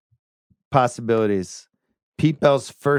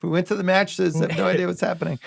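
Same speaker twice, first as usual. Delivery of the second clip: higher-pitched mock innocence; Tom Hagen; 6 semitones higher than usual. Figurehead pleading ignorance of corruption, mock-innocent imitation. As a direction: play the official who claims to know nothing. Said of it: "He's doing the Tom Hagen".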